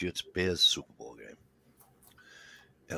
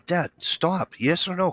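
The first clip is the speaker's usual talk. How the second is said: impatient demand; 10 semitones higher, 3 dB louder, slower than usual. Retold or imitated. Son retells demanding an answer.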